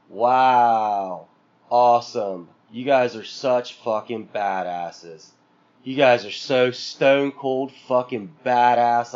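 low, slow, dripping with sarcasm; mock the wrestlers with fake awe; deadpan sarcastic congratulations; sarcastic mock praise. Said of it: "that's how I felt about it".

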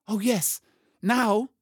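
put-on high, excited child's voice; eager girl. A narrator's reading. Lucy jumps up clapping, eager.

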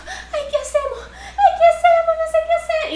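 very high laughing imitation of the friend panicking; mock panic. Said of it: "Como entró en pánico".

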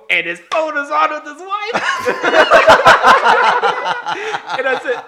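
high, loud, slow words breaking into laughter; can barely get it out for laughing; laughing through it. Real, not acted. Telling buddies the punchline, cracking up.

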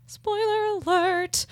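high, drawn-out sing-song; playful lilting aside; sing-song warning. Real, not acted. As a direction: chime it slowly, up high. Playful warning mid-sentence.

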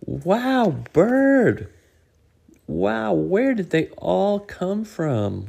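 high, loud amazed squirrel. Acted. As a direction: gasp with wonder.

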